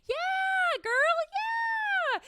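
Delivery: put-on high, shouted yeah girl; hype yell. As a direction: cheer yourself on like a hype friend. Hyping herself up.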